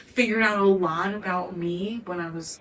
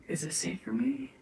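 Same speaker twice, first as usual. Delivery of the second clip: whisper; 3 semitones higher, 10 dB quieter than usual. Retold or imitated. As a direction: ask it again in a hushed whisper. Intuition question repeated in a whisper.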